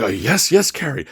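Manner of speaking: high, bright eager agreement; eager yes. Retold or imitated. Eagerly agreeing to meditate with Cary at two a.m. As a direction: jump at the offer, thrilled. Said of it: "And I'd be like".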